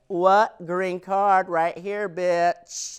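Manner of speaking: loud, high mock mother; mom voice. Mom cashing in on the wedding, loud brash voice. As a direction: bark it like a brash little mom. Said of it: "old mommy back in Mexico's like".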